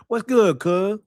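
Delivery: a hint of a background shout; imitating background voice. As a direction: toss it out like a guy off-camera. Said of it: "Listen again".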